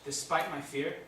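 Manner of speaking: usual speaking voice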